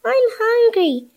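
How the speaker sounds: high, small character voice; high-pitched little plea. Acted. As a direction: pipe up in a tiny high voice. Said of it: "said Spotty in a little voice".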